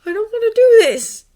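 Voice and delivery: high, loud, strained refusal; ground-out refusal. A narrator's reading. Draco trembling before Harry, ground-out anguished refusal. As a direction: force it out through rising upset. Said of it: "he ground out".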